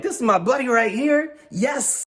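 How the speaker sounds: high-pitched hammed-up delight; gleeful. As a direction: crow about your new friend. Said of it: "Jim just puts his arm around me like".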